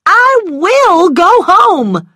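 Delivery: high, loud, drawn-out wail of defiance; desperate high-pitched insistence; tearful cry. A narrator's reading. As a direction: cry it out slowly through tears. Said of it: "she cried, tears running down her cheeks".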